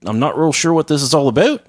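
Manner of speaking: mock Canadian wondering aloud; Canadian accent. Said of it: "my Canadian accent".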